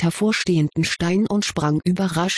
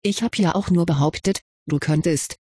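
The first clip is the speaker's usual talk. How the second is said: ordinary delivery, nothing marked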